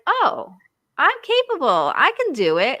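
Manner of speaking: high-pitched dawning self-confidence; confident realization. Acted. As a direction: voice a delighted "I can" realization. Acts out newly confident self-realization.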